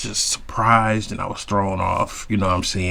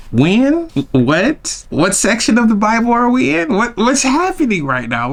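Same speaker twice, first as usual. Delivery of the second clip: horrified squeak of a man out of his depth; shocked disbelief; 10 semitones higher than usual. Retold or imitated. Shocked reaction to explanation.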